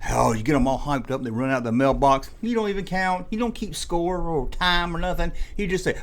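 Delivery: usual speaking voice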